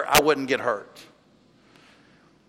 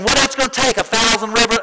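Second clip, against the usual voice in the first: higher-pitched mock-indignant griping; incredulous imitated grumble; grumbling complaint; 5 semitones higher than usual. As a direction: imitate an exasperated people haggling. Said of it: "Israel would grumble and complain".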